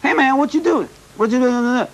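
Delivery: high, lively mock American slang greeting; imitating American street talk. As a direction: toss it off like a street guy back home. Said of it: "in my country, everybody says".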